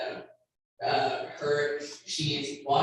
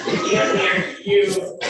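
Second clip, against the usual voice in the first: laughing; 4 semitones higher, 7 dB louder than usual. Retold or imitated. Laughing while retelling the proposal.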